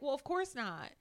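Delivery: mock Kim defending her chaos; imitating Kim. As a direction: ham up the self-proclaimed crazy one. Kim explaining herself, retold.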